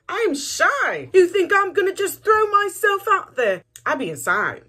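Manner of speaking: high, loud, indignant protest; overacted shocked disbelief; mock outraged. Outraged mock reply in montage.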